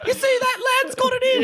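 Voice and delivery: squeaky, extremely high mock kid; childlike voice. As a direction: imitate an excited little boy in falsetto. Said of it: "I should say childlike".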